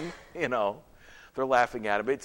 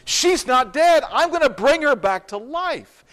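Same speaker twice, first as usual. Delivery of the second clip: high, forceful declaration; insistent, raised-voice promise; emphatic assurance; 8 semitones higher, 3 dB louder than usual. Acted. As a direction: announce it emphatically, pitched well up. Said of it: "so he says to them".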